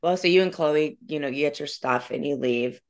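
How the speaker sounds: ordinary talk, nothing marked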